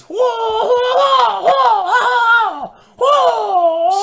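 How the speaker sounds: very high, loud kung-fu whoops; Bruce Lee battle cries. Retold or imitated. Host imitating the dubbed fighter's cries, shrieking whoops. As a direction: let out hammed-up martial arts howls. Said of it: "he always does this".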